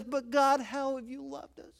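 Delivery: a sulky doubt, trailing off quietly; complaining tone. Retold or imitated. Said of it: "this tone of voice that says".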